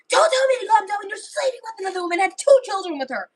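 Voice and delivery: high, fast, angry shouting; rapid-fire yelling at a cheating husband; furious. Acted. Emma refusing to calm down after Jack's betrayal, furious high shout. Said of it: "when Emma gets mad".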